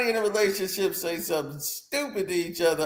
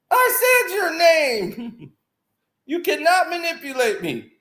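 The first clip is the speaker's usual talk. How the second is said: mock outraged reply; 10 semitones higher than usual. His own imagined reply to the text, high mock outrage. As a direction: play the indignant one, much higher. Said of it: "I'd have been".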